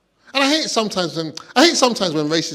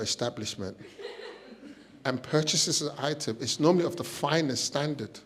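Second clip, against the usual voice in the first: posh translation; 8 dB quieter than usual. Retold or imitated. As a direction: recite it like a dowager.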